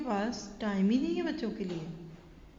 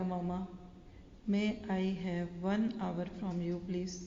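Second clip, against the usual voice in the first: put-on soft, very slow plea of a little boy; hushed, drawn-out childlike pleading; pleading child; 3 semitones lower, 5 dB quieter, much slower than usual. Son offers mother twenty dollars for an hour, soft and pleading.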